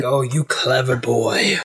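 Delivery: slow, low, sly purr; lightly voiced smug villain.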